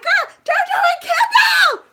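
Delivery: high, slow squeal of excitement; excited shout. Retold or imitated. Fan spotting celebrities, squealing high and loud. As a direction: shriek their names at a celebrity sighting.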